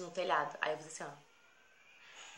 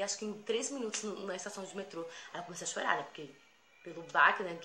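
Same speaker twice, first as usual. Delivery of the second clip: usual speaking voice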